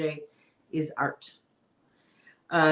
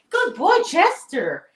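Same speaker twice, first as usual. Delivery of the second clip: much higher pet-praising voice; puppy talk; 10 semitones higher, 7 dB louder, slower than usual. Real, not acted. To her puppy Chester, much higher dog-praise voice. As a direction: coo up high at a good puppy.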